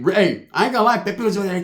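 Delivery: ordinary talk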